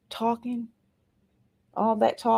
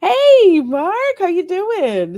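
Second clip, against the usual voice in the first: much higher and louder, a happy call; excited greeting; 12 semitones higher, 9 dB louder, slower than usual. Real, not acted. Greeting a viewer in the chat, excitedly loud. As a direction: call out to a friend who just arrived.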